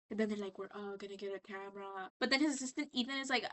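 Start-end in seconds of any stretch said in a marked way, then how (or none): 0.6-2.1 soft, hushed trance-like echo; hypnotized. Retold or imitated. Hypnotized audience echoing the TV guy, soft entranced murmur. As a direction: repeat it under a mock spell. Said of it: "he hypnotized him".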